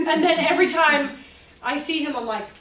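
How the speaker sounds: ordinary talk, nothing marked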